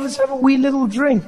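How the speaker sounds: high, giving in gladly; won-over agreement. Acted. Comic agreeing to join his Scottish friend, high and persuaded. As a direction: cave in to the temptation. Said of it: "I was like".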